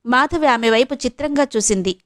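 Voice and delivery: plain reading voice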